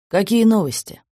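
ordinary delivery

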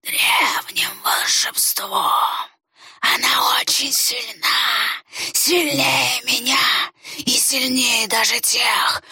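slow insinuating whisper, challenging; tempter whisper. A narrator's reading. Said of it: "Вкрадчивый шёпот таил безошибочный вызов".

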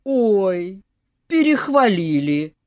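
loud mock-coy old woman; coy old granny; falsely modest granny. A narrator's reading. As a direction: wave it off with fake modesty.

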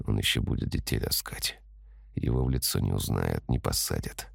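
brooding under the breath; grim inner whisper. A narrator's reading. Inner thoughts, grim resolve. (тихий мрачный шёпот)

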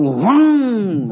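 long, swooping engine noise; imitated motorbike roar. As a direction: draw out a rising motorbike rev. Imitating a motorbike with loud exhaust.

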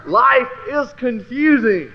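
slow, loud, high exasperation; exasperated exclaim. Retold or imitated. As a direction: drag it out loud in bafflement. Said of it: "I go".

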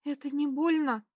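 usual speaking voice

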